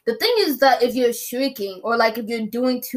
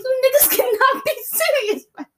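high, laughing disbelief at a funeral; incredulous laughing; 12 semitones higher than usual. Retold or imitated. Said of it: "and I was like".

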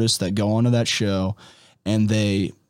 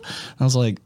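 normal talking voice

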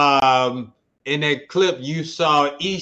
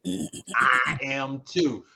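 ordinary talk

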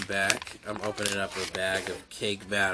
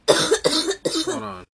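choking; 8 dB louder than usual. Real, not acted. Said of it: "went down the wrong pipe".

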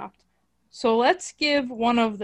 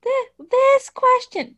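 slow, much higher mock woman voice; high falsetto woman voice; 13 semitones higher, 4 dB louder, slower than usual. Shown as a demonstration. Showing the reporter role a high woman voice. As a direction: squeeze it up into a falsetto lady. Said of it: "Do a voice like that".

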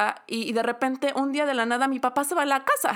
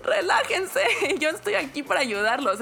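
mock pleading; 8 semitones higher than usual. Telling mom and brother to calm down, higher pleading. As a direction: plead with them to relax.

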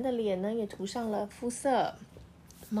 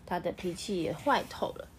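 ordinary delivery

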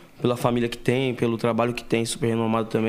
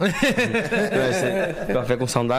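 laughing; slower than usual. Real, not acted. Joking about salty coffee.

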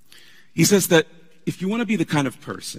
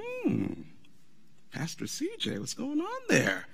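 much higher, soft teasing voice; mock teasing voice; 9 dB quieter, slower than usual. An imagined listener needling the tired pastor, high and teasing. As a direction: play the nosy asker, high and soft.

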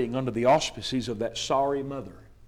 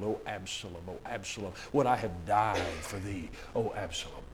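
softer, lower, understated lament; mild grieving wail; 3 semitones lower, 6 dB quieter than usual. A narrator's reading. King mourning his dead son, hushed lament. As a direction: let the grief sink the voice down. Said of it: "he cried and he wailed".

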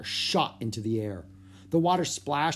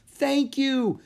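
high, loud cry of thanks; 13 semitones higher, 5 dB louder than usual. A narrator's reading. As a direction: call out gratefully over the storm. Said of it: "she cried".

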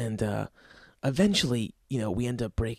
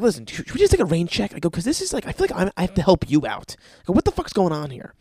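frustrated outburst; 5 semitones higher, 5 dB louder, faster than usual. Retold or imitated. Loud frustrated offer to end session.

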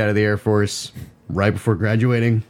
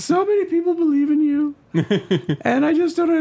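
very high, laughing mock mother; terrible mom voice. Son mocking his mother's text about believing in him, high and laughing. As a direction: fret in a squeaky mom falsetto. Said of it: "so I can do this terrible voice".

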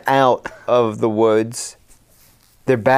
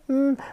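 mock whimper; 12 semitones higher, 5 dB quieter than usual. Imitated whimpering in the woods.

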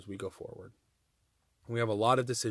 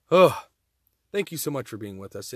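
usual speaking voice

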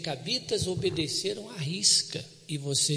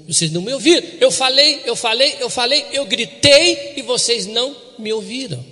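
louder, insistent repetition; God's complaint; 5 semitones higher, 8 dB louder than usual. Acted. Pastor voices God scolding the people, loud. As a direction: press the "eu falei" again and again.

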